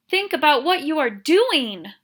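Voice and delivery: loud, high cry. A narrator's reading. Miss Catherine crying out to the mob. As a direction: plead loudly with the mob. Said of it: "cried Miss Catherine".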